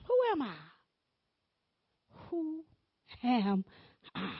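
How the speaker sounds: ordinary delivery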